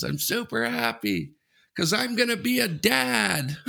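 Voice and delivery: high, stretched-out joyful mock yell; slow, high-pitched holler, held back in volume; imitated screaming. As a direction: call the good news out to a whole boat. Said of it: "With that screaming".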